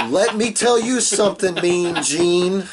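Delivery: high, loud raspy wrestler promo; Hulk Hogan. Retold or imitated. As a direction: growl it like a hyped wrestler. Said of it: "he was talking like this".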